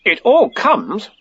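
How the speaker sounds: high, slow, mildly stern retort. A narrator's reading. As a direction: throw it back with slow emphasis. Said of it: "said Rabbit sternly".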